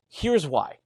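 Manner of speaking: ordinary talk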